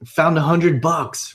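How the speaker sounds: high, thrilled exclamation; acted excitement. Coach acting out someone thrilled at finding a hundred bucks, excited. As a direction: gush like you just found money. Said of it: "let's say you're really excited".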